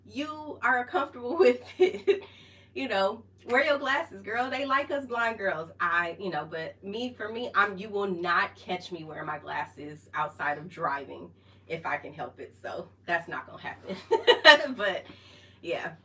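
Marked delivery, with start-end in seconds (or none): 0.1-5.7 laughing retort. Retold or imitated. Quoting herself to sister. 14.0-14.7 loud high laugh. Real, not acted. Vlogger cracking up at her own running story, loud laugh.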